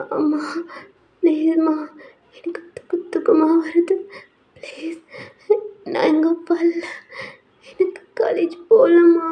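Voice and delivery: put-on high, breathy sick-girl whimper; feverish, slow whimpering plea; weak tearful pleading. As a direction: beg Mum feebly, half whispered and near tears. Sick daughter begging her mother.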